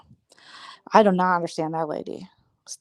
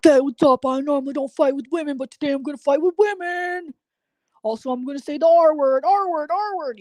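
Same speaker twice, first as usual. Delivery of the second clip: mock macho guy; 8 semitones higher than usual. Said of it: "These guys are like".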